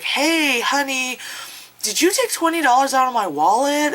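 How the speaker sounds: lightly high casual accusation; a hint of a suspicious partner; mock boyfriend. Road-trip couple bickering, lightly mocked accusing tone. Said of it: "it's like".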